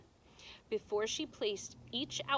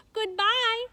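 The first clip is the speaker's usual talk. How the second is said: high loud call across the dock; girl calling to her grandmother from the ship; shouted goodbye; 12 semitones higher, 12 dB louder than usual. Acted. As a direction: shout it to someone far away. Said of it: "Abilene shouted to her grandmother".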